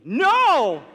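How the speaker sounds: loud, high "no"; big retold yell, voice leaping up; yelled refusal. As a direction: cry out in horrified refusal.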